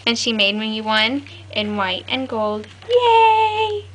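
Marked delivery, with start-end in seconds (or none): 2.9-3.8 high, loud cheer; excited squeal. Real, not acted. Learning the album was made for her, high cheer. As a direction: squeal with joy.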